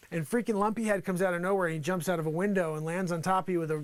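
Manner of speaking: ordinary talk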